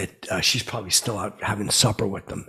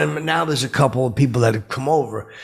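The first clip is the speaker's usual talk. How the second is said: ordinary talk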